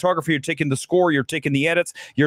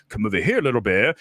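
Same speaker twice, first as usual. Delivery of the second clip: lower, heavier take on the bear line; the first take, pitched low; the version before the redo; original Doolittle voice; 7 semitones lower than usual. Shown as a demonstration. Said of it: "Before we were like".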